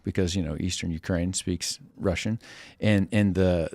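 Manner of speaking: usual speaking voice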